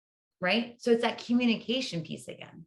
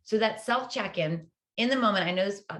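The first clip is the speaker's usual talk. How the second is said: ordinary delivery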